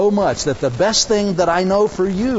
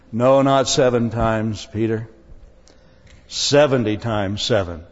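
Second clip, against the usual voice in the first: lower, firm voice as a correcting Jesus; a lowered, gently scolding Jesus; Jesus, mildly stern; 7 semitones lower than usual. Retold or imitated. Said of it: "Jesus blew his fuses".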